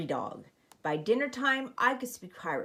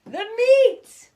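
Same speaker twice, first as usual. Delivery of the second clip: much higher, louder roar; crew roar; 12 dB louder than usual. Acted. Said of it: "we all roared".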